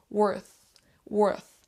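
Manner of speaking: WORTH ending in a tongue-to-teeth 'th'; TH sound. Shown as a demonstration. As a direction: isolate WORTH with a careful final TH. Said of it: "faire ce son T H".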